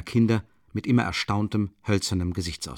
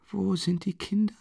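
high, feeble voice of a dying woman; weak voice; 10 semitones higher than usual. A narrator's reading. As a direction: ask faintly for the children. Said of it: "fragte sie mit schwacher Stimme".

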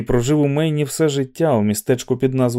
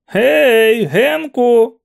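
loud, much higher shout across the road; shouted call; 12 semitones higher, 6 dB louder, much slower than usual. A narrator's reading. McVries shouts at Olson to get his attention. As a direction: call out to someone far ahead. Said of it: "крикнув Макфріс".

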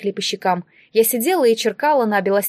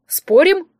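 high, louder teasing dare; smirking challenge; 7 semitones higher, 6 dB louder than usual. A narrator's reading. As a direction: throw it out as a playful dare. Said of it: "тут же ухмыльнулся мужчина". (задорный вызов с ухмылкой)

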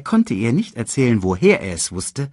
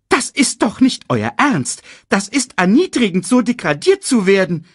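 high, loud offended bird voice; indignant protest; 13 semitones higher, 6 dB louder than usual. A narrator's reading. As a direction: protest loudly at the humiliation. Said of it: "protestierte Sasu".

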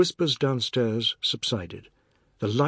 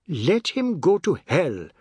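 ordinary delivery, nothing marked

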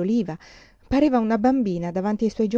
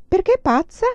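sharp, much higher and louder; indignant retort; 12 semitones higher, 8 dB louder than usual. A narrator's reading. Augusta retorts to a friend calling her crazy, indignant. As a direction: throw the word back at her.

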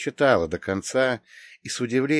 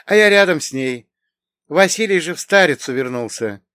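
higher, louder woman's character voice; storytelling aunt, high and emphatic; reminiscing aunt; 7 semitones higher, 7 dB louder than usual. A narrator's reading. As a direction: recount old memories in a raised pitch. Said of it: "медленно говорила Мария".

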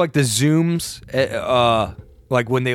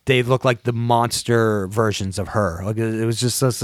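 ordinary talk

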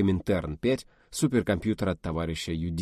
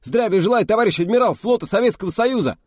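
much higher, louder parade-ground bellow; thunderous greeting; 14 semitones higher, 8 dB louder than usual. Acted. Junior officer greeting the fleet admiral, bellowing. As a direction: snap to attention and blast it out. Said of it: "и грянул".

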